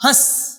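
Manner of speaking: हंस as a short "hãs", no separate n; quick, short vowel. Shown as a demonstration. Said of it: "इसको क्या बोलेंगे हम?".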